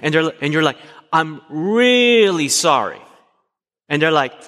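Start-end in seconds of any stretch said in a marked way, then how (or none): 1.1-3.0 drawn-out, higher mock apology, leaning on "really"; stretched, swelling "really sorry" for a doubting partner; exaggerated apology. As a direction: overdo the apology to prove you mean it. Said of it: "I didn't hear that right in, in your intonation of your voice".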